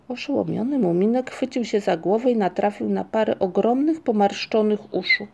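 plain reading voice

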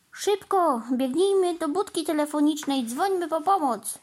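rally them to get help at once; urgent urging. A narrator's reading. Boy urges running for help.

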